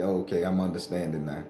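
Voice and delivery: quiet, amused understanding; quiet realization. Retold or imitated. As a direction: murmur as it clicks. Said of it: "And I say".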